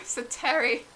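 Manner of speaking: usual speaking voice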